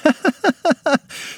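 high-pitched ticklish giggle. Acted. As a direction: squeal with tickled laughter. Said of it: "As the laughter began to peak".

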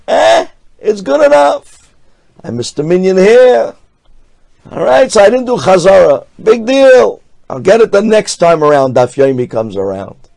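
high, loud, shrugging good-enough tone; dismissive. Acted. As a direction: brush it off carelessly. Mocking a lax attitude.

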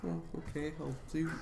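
high, nervous sorry; flustered apology. Acted. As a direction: shrink back and apologize. Camper cowed by angry leader.

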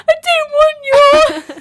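high, slow, wailing refusal; mock sobbing refusal. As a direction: wail it like a sulky sobbing child. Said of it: "I'm like".

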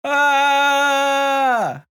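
sudden loud shout to scare a bear; bear yell. Shown as a demonstration. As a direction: bark a sharp yell at a bear. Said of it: "what's your bear yell?".

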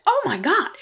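shocked reaction. Retold or imitated. Daughter-in-law feels the swollen shoulder, mimicked shock. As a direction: retell a startled "oh my God".

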